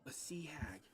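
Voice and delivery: breathy secret whisper. Acted. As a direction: whisper it fearfully. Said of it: "she's gonna be speaking very quiet".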